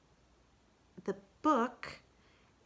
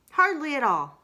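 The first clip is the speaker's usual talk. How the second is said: higher, louder brisk answer; Kanga voice; 8 semitones higher, 9 dB louder than usual. Acted. Kanga dismisses poetry, louder and higher. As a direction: brush it off lightly.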